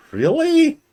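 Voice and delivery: a high, loud squeak of disbelief; a loud incredulous "Really?"; mock disbelief. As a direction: pop up in mock shock.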